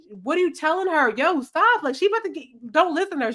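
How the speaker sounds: high-pitched, urgent protest; heated protest. Retold or imitated. Girl protesting to the friend who is blocking the money, pitched high. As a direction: stop the friend before the cash is lost.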